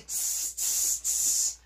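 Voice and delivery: short hissed "tss" sounds; repeated sharp voiceless "tss"; demonstrated monkey chatter. Shown as a demonstration. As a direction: show children the monkeys' hissing. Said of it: "you can say it to me".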